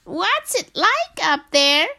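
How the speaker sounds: a frog calling up from further below. Acted. Frog voiced in picture book.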